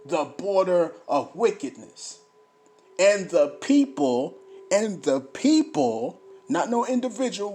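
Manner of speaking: loud, emphatic scripture reading; booming pulpit delivery; fiery preaching. Acted. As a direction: preach it to the congregation. Reads scripture, preaching loudly.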